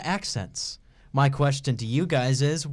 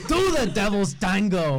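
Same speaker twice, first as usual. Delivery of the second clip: put-on brash Scottish accent; 6 semitones higher than usual. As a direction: ham up a brash Scottish come-on. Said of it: "too brash of a Scottish accent".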